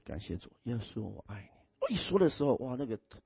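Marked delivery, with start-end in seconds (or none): 0.1-1.5 tender prayer. Retold or imitated.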